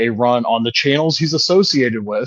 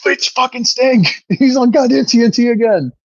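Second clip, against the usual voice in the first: thrilled shout; 11 semitones higher than usual. Retold or imitated. Fan shouting to his mom that Sting is back, thrilled and laughing. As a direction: shriek it with delight through a laugh. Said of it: "And I'm like".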